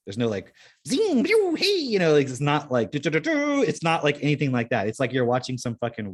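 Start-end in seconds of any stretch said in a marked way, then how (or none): 0.9-1.8 high-pitched zing and pew noises; squealing laser-blast imitations; sci-fi sound effects. Mimics sci-fi action noises. 2.9-3.6 high rapid do-do-do noise; high-pitched mock movie noise; sci-fi sound effect. Mimics action movie sound.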